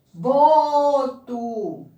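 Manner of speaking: Boto with a loud, stretched BO; stress on first syllable. Shown as a demonstration. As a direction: punch and hold the BO in Boto. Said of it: "a sílaba pronunciada com mais força".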